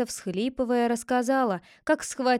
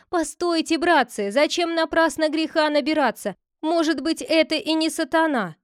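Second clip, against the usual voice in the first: voiced clerk, a little higher and louder; clerk pleading; 6 semitones higher, 4 dB louder than usual. A narrator's reading. The clerk begs the villagers not to burn her. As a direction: urge them to stop, half pleading. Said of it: "сказал писарь".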